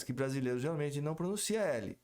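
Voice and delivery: ordinary talk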